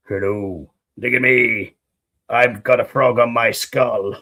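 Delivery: low, playful voice for the skull; deep character voice. Pretend. Voicing the frog skull.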